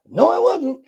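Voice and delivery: high, stubborn protest; indignant denial. Retold or imitated. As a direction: refuse it hotly. Argument with wife.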